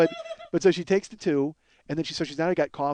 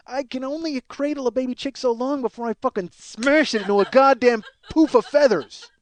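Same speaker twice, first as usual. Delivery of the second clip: high, worked-up comic rant; mock seething outburst; losing restraint; 5 semitones higher, 4 dB louder than usual. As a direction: build to a loud, frustrated smash.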